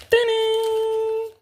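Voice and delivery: very high, sung-out fanfare; triumphant reveal. Real, not acted. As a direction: announce the big reveal, high and held. Reveals the burger.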